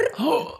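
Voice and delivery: high, sharp mock gasp; startled gasp. Friend describing lizards that come indoors, startled gasp. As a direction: suck in air as if spooked.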